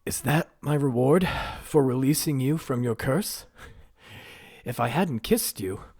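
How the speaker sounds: high, loud aggrieved protest; indignant prince. Acted. Prince protesting his reward for the kiss. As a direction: throw the reproach at her.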